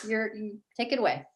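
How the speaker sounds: usual speaking voice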